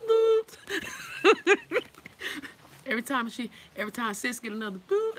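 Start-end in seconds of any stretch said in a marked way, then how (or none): 0.1-0.6 high squeaky bloop, loud; a cartoon beep, way up high; register bloop. Cashier's register ringing up items, a high loud bloop. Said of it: "She's like". 0.6-2.8 real high cackling laugh; high laughter. Real, not acted. Telling viewers about the checkout, high loud laugh.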